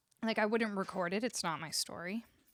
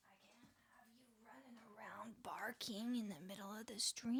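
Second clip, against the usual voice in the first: whisper; 14 dB quieter than usual. Real, not acted. Hushing dog during stream.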